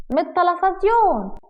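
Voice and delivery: high, drawn-out innocent answer; childlike innocence. Acted. Wife explains where she learned it, childlike innocence.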